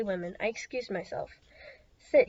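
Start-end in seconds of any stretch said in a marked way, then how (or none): 2.0-2.3 a sudden loud outburst, much louder; burst out. A narrator's reading. Servant bursting in on the hostess, loud outburst. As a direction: blurt it out loud and urgent. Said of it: "he burst out".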